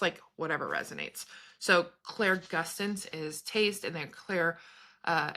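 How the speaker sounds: usual speaking voice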